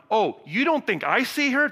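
high, loud incredulous retort; as if offended anyone doubts it; indignant challenge. Shown as a demonstration. Jesus challenging Simon, high incredulous retort. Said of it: "The emphasis is on".

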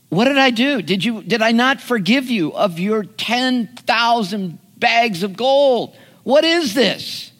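high, indignant outrage as an angry king; enraged. Retold or imitated. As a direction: play the king's furious disbelief. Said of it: "And he's enraged and says".

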